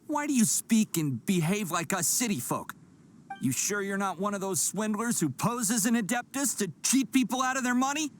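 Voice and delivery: suspicious accusation. Acted.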